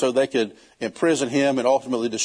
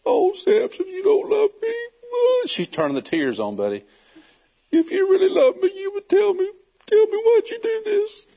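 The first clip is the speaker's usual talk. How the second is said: high falsetto fake weeping; mock crying in a high voice; 3 dB louder than usual. Delilah turns the tears on Samson, a high hammed-up fake cry. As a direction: whine through fake tears, much higher. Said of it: "She started crying again".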